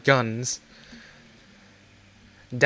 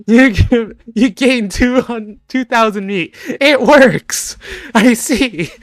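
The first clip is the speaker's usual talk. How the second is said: high laughing voice; 9 semitones higher, 10 dB louder, slower than usual. Acted. Shopkeeper reads deal, laughing.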